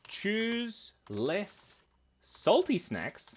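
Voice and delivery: slow, drawn-out reading; puzzled slow read from the screen. Real, not acted. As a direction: spell it out word by word. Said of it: "reading test words slowly".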